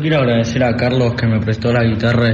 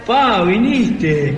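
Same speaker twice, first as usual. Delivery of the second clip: high, slow and surprised, pitch jumping up; surprised greeting; 6 semitones higher, much slower than usual. Acted. Son amazed that his father came to the show, high and drawn out. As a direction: stretch it out in disbelief, as if he never expected Dad.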